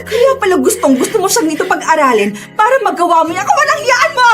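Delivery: high-pitched accusation through sobs; outraged, voice high with tears; tearful rage. Acted. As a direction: accuse him, crying with fury. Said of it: "Galit na galit talaga ako".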